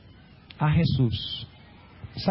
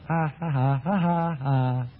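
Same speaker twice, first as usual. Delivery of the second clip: mocking laugh; 6 semitones lower, slower than usual. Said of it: "como diciendo".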